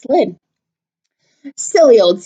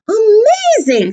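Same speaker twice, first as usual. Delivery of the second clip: high, slow, drawn-out "Amazing"; amazed; 13 semitones higher, 4 dB louder, slower than usual. Acted. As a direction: stretch it in wonder. Gruffalo amazed.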